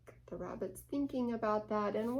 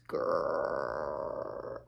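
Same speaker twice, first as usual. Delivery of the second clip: very low, slow growl of a name; growly name. Acted. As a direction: growl it like a grumpy bear. Name of unfriendly old neighbor.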